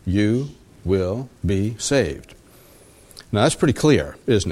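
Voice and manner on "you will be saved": word by word, very slow and softer; slow right down, softly spacing each word; slow, deliberate. Real, not acted. Preacher reading the promise of Romans to the congregation, slow and deliberate.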